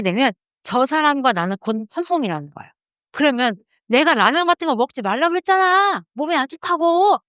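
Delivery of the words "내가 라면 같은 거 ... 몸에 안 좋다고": high-pitched scolding nag; nagging spouse. Acted. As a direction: scold a partner like family.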